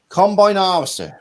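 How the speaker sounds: mock Gloucester accent, high and loud. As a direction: belt it out in a Gloucester yokel accent. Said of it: "No, that's Gloucester. Gloucester's more like that".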